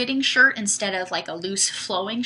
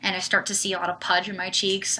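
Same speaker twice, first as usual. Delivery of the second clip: normal talking voice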